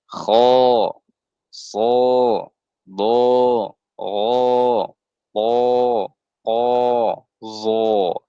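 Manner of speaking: "Kho, so, do, ro, po, ko, zo" full and long; thick, heavy letters. Shown as a demonstration. Teacher demonstrates thick letters, each long and heavy. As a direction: round each syllable heavily at the back of the mouth. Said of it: "itu semua tebal".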